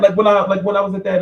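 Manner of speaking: ordinary talk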